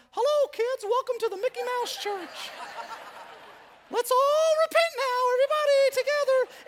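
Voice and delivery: very high, loud squeaky cartoon voice; Mickey Mouse. Preacher mocking his own voice as Mickey Mouse, squeaky. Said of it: "in my voice, it's like I'm".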